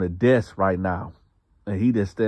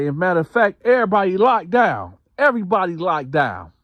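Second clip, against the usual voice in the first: high, loud lockdown call; guard yelling orders; 10 semitones higher, 5 dB louder than usual. Retold or imitated. Guard shouting the whole block into lockdown. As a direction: bark it down the tier like a guard. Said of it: "So he was like".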